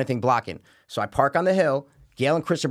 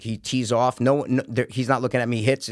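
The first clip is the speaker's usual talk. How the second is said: ordinary delivery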